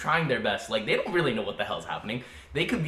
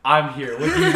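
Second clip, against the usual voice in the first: mock heroic; 2 semitones higher, 8 dB louder than usual. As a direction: rush in like a hero. Heroic doctor answers the call.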